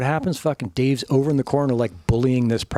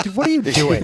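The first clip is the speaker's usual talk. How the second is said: higher, louder indignant call; a startled friend protesting; mock outrage; 8 semitones higher, 4 dB louder than usual. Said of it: "You're like".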